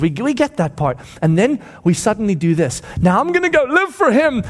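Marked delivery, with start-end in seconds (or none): 3.0-4.4 high-pitched, zealous declaration; overconfident vow. Acted. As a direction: declare it with swelling bravado. Believer's overconfident vow, acted.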